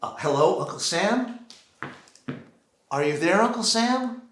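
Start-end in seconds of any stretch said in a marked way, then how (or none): none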